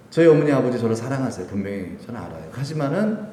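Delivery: usual speaking voice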